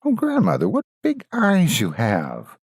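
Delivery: put-on wondering girl. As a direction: marvel at each strange feature. Girl questions disguised wolf.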